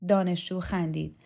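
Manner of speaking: ordinary delivery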